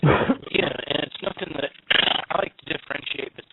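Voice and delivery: staticky phone line; crackly cordless phone. Real, not acted. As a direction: voice breaking up over a bad phone. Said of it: "You're all staticky".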